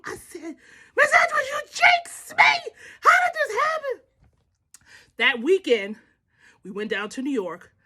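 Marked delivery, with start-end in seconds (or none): 1.0-4.0 very high shriek of blame; retold hysterical protest; mock wailing accusation. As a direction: wail it like you have been cursed. Acts out blaming her mother-in-law.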